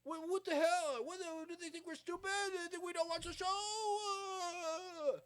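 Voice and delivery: high, loud, outraged yelling in a cracking voice; mock outrage, voice breaking with disbelief; ranting Pat McAfee. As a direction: rant like a wounded fan who feels insulted. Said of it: "and he was like".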